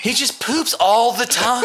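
high, slow teasing quote of him through laughter; mock voice. Retelling her husband's complaint, high mocking laugh. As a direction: mimic the man's claim, laughing and high.

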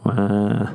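short mock wail, wah; hammed-up fake crying wail; a lazy pretend sob; fake baby wail. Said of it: "I'll just fake cry".

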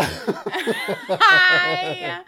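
laughing. Real, not acted. Laughing at own failure.

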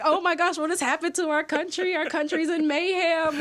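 aghast at news from home; retold dismay. Students hearing their country is in mayhem, shocked high dismay.

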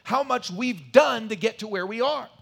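loud, high, driving sermon voice; forceful preaching. Acted. Preacher at full force, opening.

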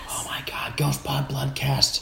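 slow whispered sign-off; hushed show name, drawn out. Real, not acted. Whispering the podcast name so the wife sleeps. Wife asleep, everyone be quiet.

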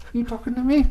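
high, quiet mock Italian "you talking to me"; a clumsy Italian-American tough guy try; bad Italian accent. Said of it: "That was the worst Italian accent ever".

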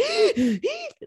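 sharp high gasping breath; high gasp of panic; panicked gasp. Shown as a demonstration. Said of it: "breathing like that".

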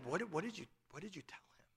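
urgent whispered question, fading to pure breath. Retold or imitated. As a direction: ask it under your breath so the kids won't hear. Wife whispers during charades.

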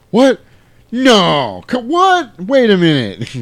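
loud, high, stammering shock; hammed-up double take, voice leaping up; flustered disbelief. Goose's flustered reaction, acted.

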